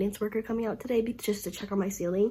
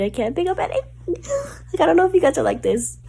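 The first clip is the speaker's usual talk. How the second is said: tearful; 9 semitones higher, 6 dB louder than usual. Real, not acted. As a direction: voice breaking, close to tears. Upset about not reading.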